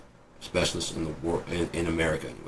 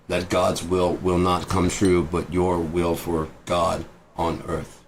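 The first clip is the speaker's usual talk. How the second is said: slow, measured proclamation; solemn declaration; slower than usual. Acted. Man confronted with evidence against God, slow and solemn declaration. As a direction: spell it out phrase by phrase. Acts solemn formal statement.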